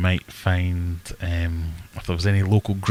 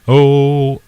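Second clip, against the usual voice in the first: loud, sharp, high "Oh"; sudden startled oh; 6 semitones higher, 10 dB louder than usual. Real, not acted. Streamer caught off guard on camera, a loud startled oh. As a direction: blurt it out as if something just went wrong.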